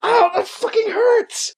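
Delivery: very high, drawn-out acted yelp of pain; pained cry. A narrator's reading. Katie is shot in the shoulder, crying out high in pain. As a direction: cry out as if just hit, without extra volume. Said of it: "she shouts".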